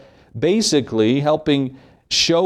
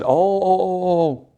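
higher, pleading oh oh; lightly voiced begging brothers; mock pleading brothers; 6 semitones higher, slower than usual. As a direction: play the frightened brothers begging. Brothers pleading before Joseph.

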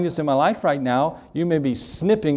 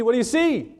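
much higher pressing repeat; insistent repeat; 11 semitones higher than usual. Retold or imitated. Samuel repeating his question to the witch, very high. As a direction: push it up even higher, insisting.

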